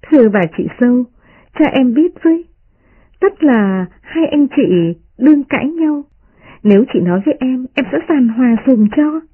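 put-on high-pitched teasing young lady; voiced girl offering help in jest; smiling mock-respectful girl. A narrator's reading. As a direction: address her with playful mock deference, pitched high. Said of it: "vỗ vào vai Tập Nhân cười nói".

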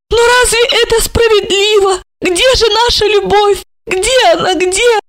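high, loud, helpless plea; helpless babbling. A narrator's reading. Olga pleads helplessly with Marina, high and lost. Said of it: "беспомощно лепетала Ольга".

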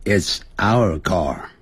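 慢速示范回答; 放慢给学生跟念; slow model answer. Shown as a demonstration. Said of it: "这是我们的车子". (慢慢示范 It's our car)

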